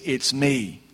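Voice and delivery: soft, hushed comfort; Jesus calming the disciples, softly; gentle reassurance. Acted. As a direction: soothe frightened friends quietly. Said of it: "He said".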